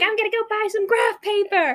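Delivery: squealed high and loud; a hammed-up shriek of a line; high mock scream. Kit runs out of the store shrieking in a high mock scream. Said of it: "Like, she's screaming".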